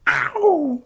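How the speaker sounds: hoge juichkreet met lach; triumphant whoop and laugh. Real, not acted. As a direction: laat een jubelkreet horen. Triumphant cry then laugh.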